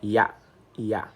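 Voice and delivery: "Ya" said twice, clear and separate; teacher repeating a syllable; Arabic syllable demo. Shown as a demonstration. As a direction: model the syllable "ya" for learners. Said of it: "Donc, c'est ya".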